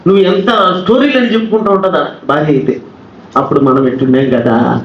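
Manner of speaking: normal talking voice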